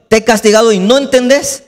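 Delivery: high, rising reproach; exasperated scolding. Acted. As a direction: scold like a parent out of patience. God scolds rebellious Israel, acted.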